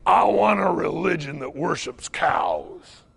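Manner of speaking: slow, put-on cowboy voice; drawn-out cowboy impersonation; cowboy customer. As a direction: play a cowboy stating his order at the counter. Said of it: "You could hear his spurs rattling".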